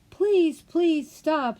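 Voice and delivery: high, loud desperate yell for the rabbit to stop. A narrator's reading. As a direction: call out after him, pleading, high and loud.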